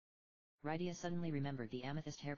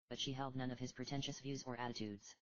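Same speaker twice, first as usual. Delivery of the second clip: ordinary delivery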